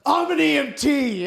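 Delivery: mocking eager EMT.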